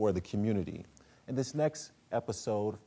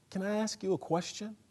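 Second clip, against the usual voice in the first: put-on much higher, timid boy's voice; Corey; 12 semitones higher than usual. Son asking his father a hesitant question. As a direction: ask carefully, like a son afraid of his father.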